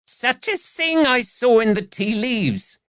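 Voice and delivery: put-on high, slow, piercing old crone; a witchy old gossip, penetrating. A narrator's reading. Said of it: "Her voice was quite penetrating".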